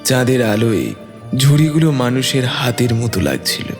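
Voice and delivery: ordinary delivery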